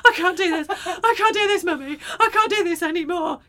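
put-on high, loud child's wail; hammed-up breathless panic with laughter; mock panic attack. Mother imitates daughter wailing to mummy in panic, laughing mock wail. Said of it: "in between the not breathing, she's going".

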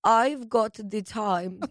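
put-on slow, clipped German accent. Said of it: "how do you do a German accent".

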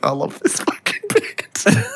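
high, soft, slow imitation of mum; gushing mum put-on; whispery high mock mum. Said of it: "she's like".

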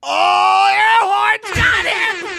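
high, drawn-out blaring yell; airhorn shout. Pretend. Co-host cutting in with an airhorn gag, high blaring shout. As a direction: blare it out like an airhorn, long and high.

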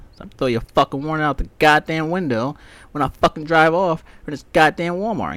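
ordinary delivery

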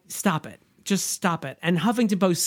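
ordinary delivery

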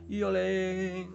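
loud, high, drawn-out Spanish olé; mock Spanish olé. Cheering olé at his viewers, in a hammed-up Spanish accent. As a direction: belt it like a flamenco cheer. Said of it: "me está saliendo español".

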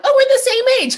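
high, loud burst of breezy agreement; mock exclamation. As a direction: exclaim it as if it's obvious. Acting out breezy dismissal of age gap.

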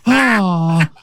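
lightly hammed-up film star voice; Nicolas Cage. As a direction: draw out the moans in mock impersonation. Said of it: "So Nicolas Cage, we're talking Raising Arizona".

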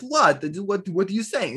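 high-pitched, clueless "what?"; mock incomprehension. Mocks a character not listening.

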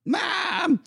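high whiny cry for mom; whiny kid call. Pretend. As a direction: wail for mom like a little kid.

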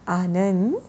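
otherworldly summons; different mystery voice. Acted. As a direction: call out to the boy as a mysterious new voice. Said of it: "ये थोड़ी different आवाज़ थी".

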